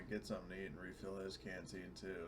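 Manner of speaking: usual speaking voice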